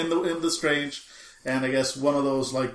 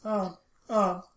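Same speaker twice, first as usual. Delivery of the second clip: high, drawn-out words with trailing repeats; mock echo; 7 semitones higher, 4 dB louder, slower than usual. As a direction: imitate an echo after each phrase. Acted echoing reply in the void.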